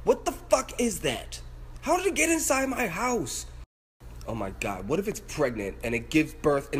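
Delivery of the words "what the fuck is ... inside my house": high, drawn-out disbelief; real high-pitched freak-out; freaked-out outburst. Real, not acted. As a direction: wail it high, alarmed at an intruder. Freaking out at the bug.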